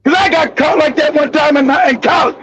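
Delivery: ordinary delivery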